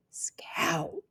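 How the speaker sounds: whispered name, barely any voice; breathed. Acted. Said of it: "breathed Jim".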